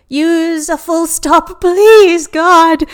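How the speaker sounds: loud, high, drawn-out plea; exasperated cry to the heavens; exasperated outburst. Real, not acted. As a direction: wail it at the writer, slow and loud. Exasperated at the text's punctuation.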